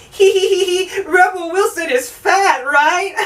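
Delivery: high-pitched giggly mock voice; mocking idiotic humour. Movie joking about Rebel Wilson, high mocking giggle. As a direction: squeal it with fake snickering. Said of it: "movie's idea of comedy is going".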